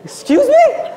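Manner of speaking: high, loud disbelieving yelp; incredulous high-pitched outburst; shocked. Acted. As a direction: squeal it in sheer disbelief. Shocked reaction mid-story.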